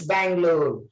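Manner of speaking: normal talking voice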